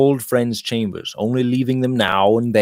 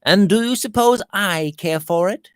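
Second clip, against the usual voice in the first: put-on breezy young gentleman, pitched higher; lively higher young man's voice; Robert's voice; 10 semitones higher than usual. A narrator's reading. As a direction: lift the voice for cheerful Robert. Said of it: "cried Robert".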